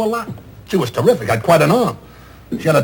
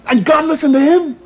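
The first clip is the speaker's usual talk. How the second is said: usual speaking voice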